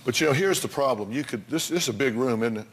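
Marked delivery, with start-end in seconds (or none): none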